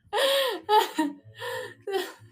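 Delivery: loud, high sobbing wail; mock sobbing. Retelling getting emotional before the hug, loud sobs. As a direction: bawl in bursts, hammed up.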